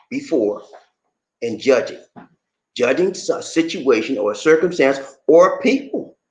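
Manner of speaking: ordinary delivery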